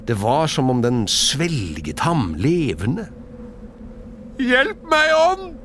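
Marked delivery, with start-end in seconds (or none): 4.4-5.5 very high, loud plea; crying for help. A narrator's reading. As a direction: shout desperately for rescue. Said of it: "ropte Scrooge". (desperat rop om hjelp)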